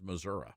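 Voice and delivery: Missouri ending in "uh". Shown as a demonstration. Recalling how old rural shows said it, uh ending. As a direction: say Missouri as muh-ZUR-uh. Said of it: "they always refer to it as".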